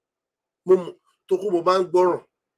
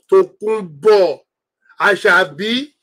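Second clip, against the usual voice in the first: drawn-out call; much slower than usual. Real, not acted. Calling out to a viewer.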